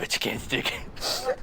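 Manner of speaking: slow, whispery mock impression; Cortana. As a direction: whisper it slowly, softly, in character. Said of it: "How about your Cortana?".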